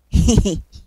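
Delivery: short hee-hee chuckle. A narrator's reading. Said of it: "chuckled Rufus".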